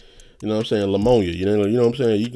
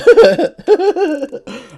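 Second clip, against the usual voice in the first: high laugh; 10 dB louder than usual. Real, not acted. Laughs loudly.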